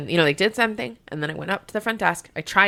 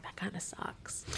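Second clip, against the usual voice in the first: whispered aside; 21 dB quieter, slower than usual. Retold or imitated. Muttered aside to herself.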